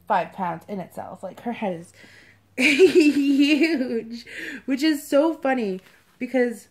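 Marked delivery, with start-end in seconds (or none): 2.6-4.3 HUGE stretched out loud through laughter; laughing emphasis. Real, not acted. Laughing at the doll's huge head.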